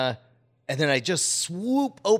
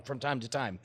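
ordinary talk, nothing marked

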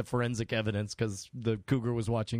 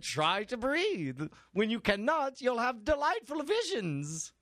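high, slow infomercial voice; infomercial pitch; 8 semitones higher than usual. Acted. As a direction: sell it like a late-night ad. Ad pitch for the invention.

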